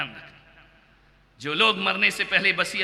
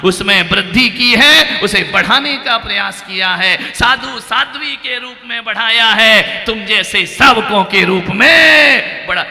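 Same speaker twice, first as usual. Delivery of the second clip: louder, a raised preaching voice; 3 semitones higher, 8 dB louder than usual. Real, not acted. Monk preaches to the congregation, raising his voice. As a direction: preach it out loud to the hall. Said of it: "इसलिए मुझे जोर जोर से आवाज लगाना पड़ता है".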